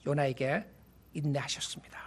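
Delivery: breathy hushed whisper. Real, not acted. Preacher to congregation, whispering God's patience. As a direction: drop to a whisper to make it sink in.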